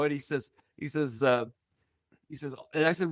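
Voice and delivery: ordinary talk, nothing marked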